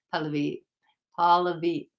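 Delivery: ordinary talk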